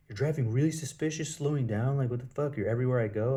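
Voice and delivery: loud, high, aggressive accusing rant; loud high imitated road rage; angry accusation. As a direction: shout it at him like a suspicious stranger. Retold angry stranger accusing him.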